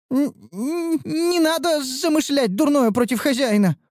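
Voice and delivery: high stammering squeak. A narrator's reading. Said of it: "пискнула шмакодявка-магичка".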